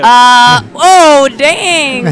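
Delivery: high, loud excited reaction. Real, not acted. Sees a player's reaction.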